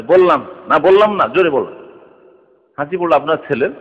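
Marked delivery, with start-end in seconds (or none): 0.0-1.8 put-on raised voice correcting a child; parent scolding, louder. Firm scolding call. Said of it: "তার আস্তে করে অনেক সময় বলে".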